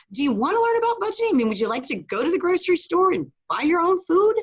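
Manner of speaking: high, fast, upbeat pitch; eager offer. Retold or imitated. Coach offering to teach budgeting, eager and fast. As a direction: sell the idea brightly.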